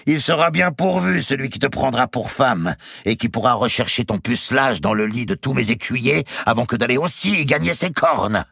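high, loud tirade of scorn; furious jeering. A narrator's reading. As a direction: tear into her at high pitch. Countess rages at her servant.

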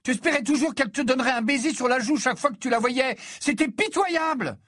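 very high furious tirade; high-pitched raging rant; enraged screaming. A narrator's reading. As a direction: hurl scornful accusations at your brother. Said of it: "hurla Ginny, folle de rage".

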